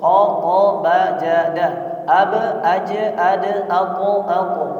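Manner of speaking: each letter said with a short echo; bounced letters. Shown as a demonstration. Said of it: "yang dipantulkan cuma ada lima huruf". (huruf qalqalah dipantulkan)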